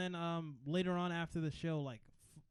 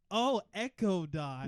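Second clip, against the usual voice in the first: loud, slow, stretched-out words; mock realisation; 3 semitones higher, 6 dB louder, slower than usual. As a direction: act out the penny finally dropping. Friend re-enacts the shared realisation.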